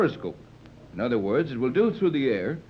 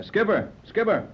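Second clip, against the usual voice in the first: higher call across the deck; 7 semitones higher than usual. Acted. Tex summoning the skipper forward, calling out.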